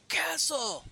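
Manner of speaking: high shouted call across a hall; sudden high call of his name. Acted. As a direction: yell the name up high to get his attention. A comic character calls out Castle.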